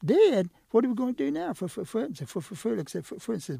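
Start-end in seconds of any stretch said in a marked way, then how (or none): 0.0-1.5 shocked. Acted. Hearing cousin is dead.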